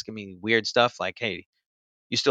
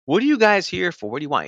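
higher-pitched, questioning the visitors; retold wary challenge; 8 semitones higher than usual. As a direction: imitate a pushback at the door, pitch jumping up. Retold confrontation with agents at door.

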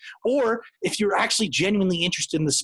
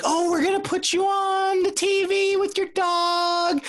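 chirpy fake-friendly PR voice; customer service voice; 12 semitones higher than usual. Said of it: "Is that your customer service voice?".

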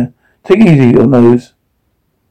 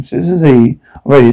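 usual speaking voice

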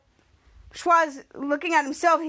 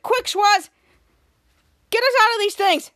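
higher-pitched mock urgency; retold urgent order; 4 semitones higher, 4 dB louder than usual. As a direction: imitate a character urging a friend on. Retold urgent order to free them.

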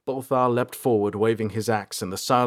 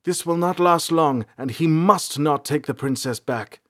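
urgent; 6 semitones higher, 4 dB louder than usual. Acted. Urgent warning.

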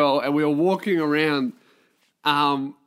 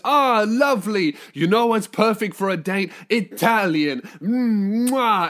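hammed-up Italian restaurant host, higher and effusive; Italian accent; 4 semitones higher, 4 dB louder, slower than usual. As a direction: sell it with a showy Italian accent. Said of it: "a dude with an Italian accent".

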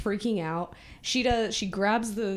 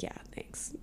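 hushed grudging reply; 15 dB quieter than usual. Retold or imitated. Retold girl grudgingly answers about hair.

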